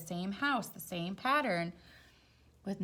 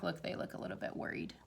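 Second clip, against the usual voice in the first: quick hushed whisper; 7 dB quieter, much faster than usual. Real, not acted. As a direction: lean in and whisper it fast. Hushed aside to child.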